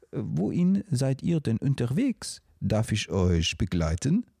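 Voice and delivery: put-on high, French-accented moose voice. French moose Edgar speaks.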